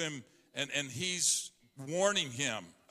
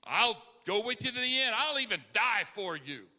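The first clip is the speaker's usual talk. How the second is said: pitched high, fast, bold vow; brash loyal disciple; boastful Peter; 7 semitones higher, 3 dB louder than usual. Acted. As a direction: swear you'll defend Jesus to the death. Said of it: "Peter said".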